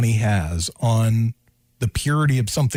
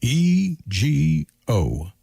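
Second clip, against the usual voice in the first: very slow, letters spelled out one by one; Ned Reynolds; 2 semitones higher, much slower than usual. Acted. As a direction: draw out each letter in a broadcaster impersonation. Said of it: "I'll do my best Ned Reynolds impersonation".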